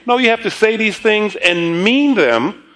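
ordinary talk, nothing marked

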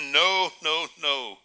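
usual speaking voice

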